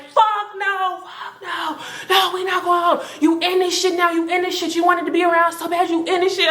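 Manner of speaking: high, shouted refusal; they refuse to take her home; mock harsh refusal. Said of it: "They was like".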